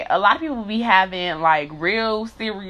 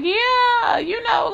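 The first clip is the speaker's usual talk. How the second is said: retold shout; much slower than usual. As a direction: yell back a sarcastic yes, pitched way up. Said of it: "And I was like".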